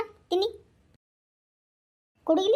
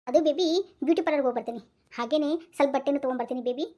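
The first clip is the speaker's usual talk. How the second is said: ordinary delivery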